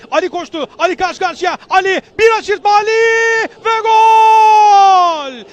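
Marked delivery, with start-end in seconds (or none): 1.7-5.5 long held goal shout; screaming football commentator. Real, not acted. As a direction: roar the goal call. (uzun gol çığlığı atan spiker)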